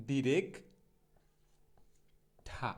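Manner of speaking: ডিরেক্ট, long pause, then aspirated ঠা; syllable-by-syllable demo. Shown as a demonstration. Teacher breaking down director, ডিরেক্ট then ঠা apart. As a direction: break it into "direk ... tha". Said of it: "সঠিক উচ্চারণটা যেটা হবে".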